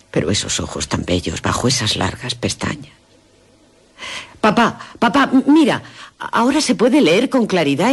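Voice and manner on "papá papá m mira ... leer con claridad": llamada emocionada. Acted. Descubre el nombre, llama.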